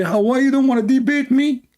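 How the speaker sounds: high mocking sneer; squeaky taunting voice; mocking whiny taunt.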